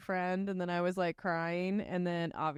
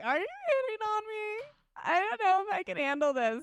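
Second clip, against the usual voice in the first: much higher, crying-edged question; panicked disbelief. Retold or imitated. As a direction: squeak it out, overwhelmed and scared. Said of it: "I was like".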